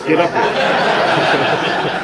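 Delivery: loud, breathy laughing summons; real laughter calling a friend forward. Real, not acted. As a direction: call him up while laughing.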